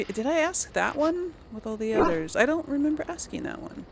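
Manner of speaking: ordinary talk, nothing marked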